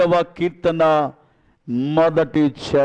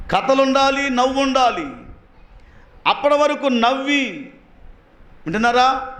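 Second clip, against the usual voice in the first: high, ringing preacher voice at full force; 9 semitones higher than usual. Acted. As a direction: preach it hard to the congregation. (ఉత్సాహంగా బోధించడం)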